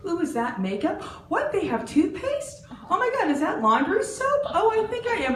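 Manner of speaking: high, excited gasps at each item; dazzled shopper. Retold or imitated. Shopper gushing over dollar store goods, high and excited. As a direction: marvel at every shelf like a kid. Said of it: "you go in and you're like".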